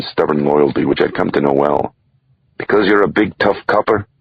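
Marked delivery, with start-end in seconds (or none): 2.6-4.1 higher, mocking Irish tease; nanny gently mocking the policeman, teasing. A narrator's reading. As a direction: needle him playfully about being tough.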